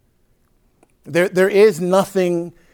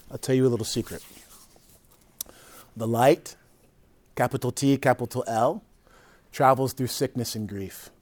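soft, low, confidential delivery; confiding a secret; 3 semitones lower, 5 dB quieter than usual. Real, not acted. Teacher telling listeners a little secret, quietly confiding. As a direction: lower your voice to share a secret.

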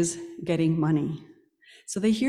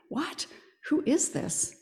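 high puzzled question; a touch of the city's surprise; surprised; 7 semitones higher, 4 dB quieter than usual. Retold or imitated. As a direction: ask in startled surprise. City stirred up, asking.

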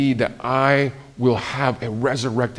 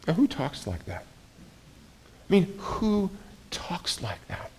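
awed whisper; 5 semitones higher, 9 dB quieter than usual. Real, not acted. Preacher asks the congregation in awe, whispered. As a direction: whisper it in wonder.

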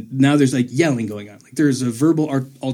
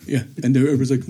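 ordinary talk, nothing marked

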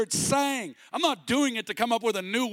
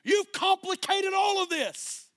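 Jesus rebuking; 9 semitones higher than usual. Retold or imitated. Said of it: "And what was he saying?".

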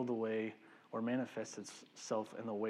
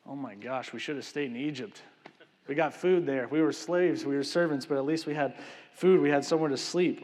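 grumbling complaint; 3 semitones higher, 6 dB louder than usual. Retold or imitated. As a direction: moan like an ungrateful crowd. Israelites grumbling about Egypt.

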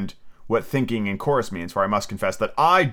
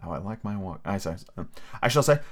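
ordinary delivery, nothing marked

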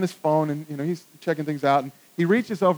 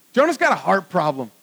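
normal talking voice

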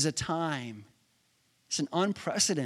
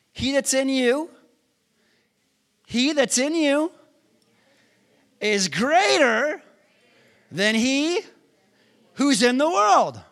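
preaching at full force; 8 semitones higher, 6 dB louder than usual. Real, not acted. Preacher declaring the verse to the congregation, shouting. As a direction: hammer each phrase out.

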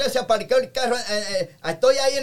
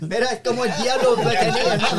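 laughing. Real, not acted. Laughing at his own story.

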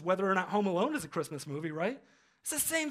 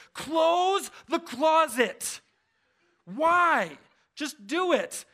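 slow, higher, louder barked order; angry parent command; 7 semitones higher, 5 dB louder, much slower than usual. Retold or imitated. Father scolding his kids about the closet, angry and slow. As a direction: stretch out the command like a fed-up dad. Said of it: "I'm angry about the closet".

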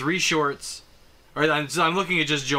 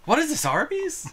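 mock surprised question; 12 semitones higher than usual. Mocking the movie line.

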